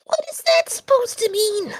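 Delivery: high, loud alarmed question. Acted. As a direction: shoot back in alarm. Alarmed at ominous remark.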